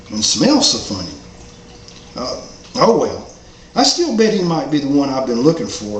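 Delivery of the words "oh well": ordinary delivery, nothing marked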